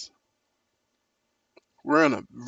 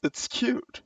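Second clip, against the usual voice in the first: mocking cute; 11 semitones higher, 3 dB quieter than usual. Mocking pretty marketing.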